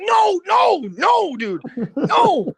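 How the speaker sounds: high laughing shout of no, no, no; panicked no. Retold or imitated. Narrator frantically stopping the bodyguard's challenge, high panicked shout. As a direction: yelp to stop a fight before it starts. Panicked trying to stop a fight.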